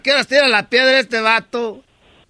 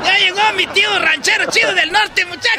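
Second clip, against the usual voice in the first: excited calling out; 8 semitones higher than usual. Acted. Child calling family to greet uncle.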